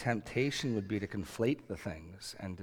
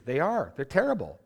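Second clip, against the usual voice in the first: emphatic insistence; 5 semitones higher, 6 dB louder than usual. Real, not acted. Preacher insists people are wicked.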